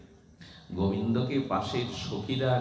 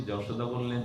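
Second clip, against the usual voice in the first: normal talking voice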